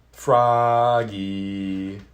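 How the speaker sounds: long, loud call across the house; mother calling loudly for her son. A narrator's reading. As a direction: call him from the next room, drawn out. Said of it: "called his mother".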